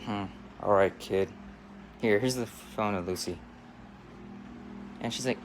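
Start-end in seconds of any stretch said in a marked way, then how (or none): 0.0-3.4 low father voice; grudging dad. Acted. Father reluctantly handing over the phone, low-voiced. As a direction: give in, keeping it deep. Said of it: "with my daughter".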